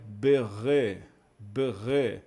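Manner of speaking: "berre" slowly, shva joined onto the following syllable; teacher's slow demo of "berre", B not split off; joined first syllable. Shown as a demonstration. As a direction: sound out "berre" as one unit, B running into RE. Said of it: "ele não forma uma sílaba separada".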